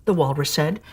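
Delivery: ordinary delivery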